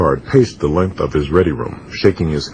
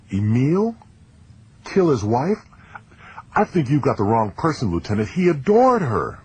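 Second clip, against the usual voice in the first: put-on young, pitched-up voice, stunned disbelief; a shocked boy defending his friend, voiced higher; teenage ensign voice; 9 semitones higher than usual.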